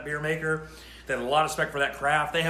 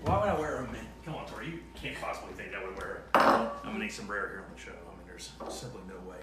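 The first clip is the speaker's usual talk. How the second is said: mock indignant deep voice; 8 semitones lower, 5 dB quieter than usual. Host refusing the mini sombrero, in a mock deep indignant voice. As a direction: play offended in a deep mock voice.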